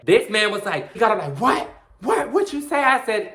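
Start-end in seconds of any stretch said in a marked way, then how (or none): 1.4-2.8 high-pitched, confrontational questioning; mock outraged challenge. Boy gets up at hearing the insult, mock outrage. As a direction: fire it back as an imitated indignant challenge.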